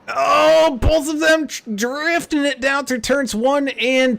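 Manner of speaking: high, loud, thrilled race call; excited call. Real, not acted. Race announcer calls two cars drifting, excited high call. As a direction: ride the action way up in pitch. Announcer calling sideways contact.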